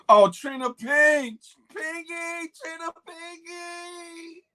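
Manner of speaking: high, drawn-out, loud sing-song shout of a viewer's name; excited greeting. Real, not acted.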